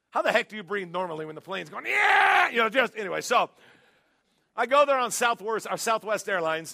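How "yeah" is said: high, loud imitated panicked scream; comic terrified shriek; mock scream. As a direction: yell like the plane is going down. Imitating plane passengers screaming.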